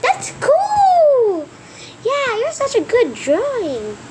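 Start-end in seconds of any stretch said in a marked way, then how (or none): 0.0-1.5 high, drawn-up exclamation; admiring. Acted. Character admires a drawing.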